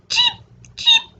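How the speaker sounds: two very high, short cheeps; baby chick cheeps. A narrator's reading. Storyteller voices the little baby chicks, high cheeps. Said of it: "The little baby chicks say".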